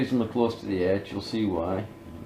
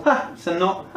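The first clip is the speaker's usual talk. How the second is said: laughing; 13 semitones higher than usual. Real, not acted. Laughing at failed screw.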